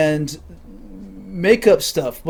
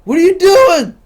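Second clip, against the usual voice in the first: mock scolding; 11 semitones higher, 6 dB louder than usual. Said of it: "my friends are like".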